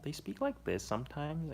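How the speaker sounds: high-pitched, extra-level American accent; American accent with swooping intonation; exaggerated American accent. Shown as a demonstration. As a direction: push the American accent up into a caricature. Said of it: "I can impersonate American accents like this".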